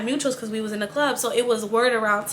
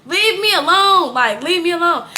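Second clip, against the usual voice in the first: tipsy yell to be left alone; drunk outburst; 4 semitones higher, 5 dB louder than usual. Retold or imitated. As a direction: belt it out like you've had too many. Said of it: "and I'm drunk".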